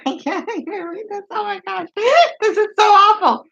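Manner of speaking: giggling through the words, half appalled; laughing in disbelief. Real, not acted. Laughing in disbelief while reading a crude message to viewers. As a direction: laugh it out, can't believe what she's reading.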